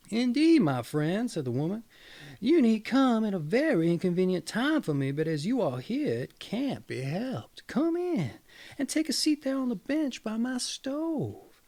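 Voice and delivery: high, put-upon hostess voice; grudging wife. Acted. Wife letting the gossip in at a bad time, grudging. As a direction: welcome him as if it pains you.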